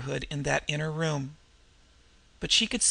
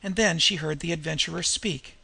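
ordinary delivery

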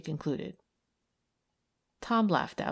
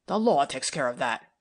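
lowered male voice, a mild retort; a hint of a stern policeman snapping back; 3 semitones lower, 5 dB louder than usual. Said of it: "retorted Tom".

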